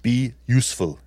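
Arnold-style Austrian accent. Shown as a demonstration. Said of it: "Can you do the accent?".

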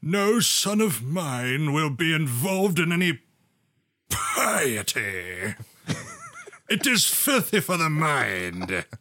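slow, stern disapproving father. Acted. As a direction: play the strict old-fashioned father. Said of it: "I would like to play the part of the father, and I can't do a German accent".

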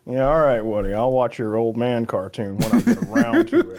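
low, dismissive mock reply; retold reluctant agreement. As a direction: act out grudgingly humouring someone. Acting out own reluctant reply.

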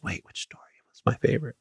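panicked whisper. Retold or imitated. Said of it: "I'm like".

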